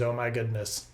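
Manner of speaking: hushed, awed oh my goodness; soft gasp of amazement; mock awe. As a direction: breathe it out in quiet wonder. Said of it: "that's all they say is".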